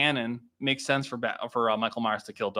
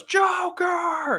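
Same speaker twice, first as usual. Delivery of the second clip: much higher roared name; Clayface yell. Retold or imitated. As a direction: bellow it out like the monster. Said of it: "turns into Clayface and goes".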